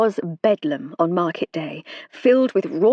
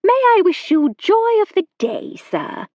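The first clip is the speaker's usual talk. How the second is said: cheery society lady; 12 semitones higher, 5 dB louder than usual. A narrator's reading. Lady Farley Stroud voiced, cheery greeting.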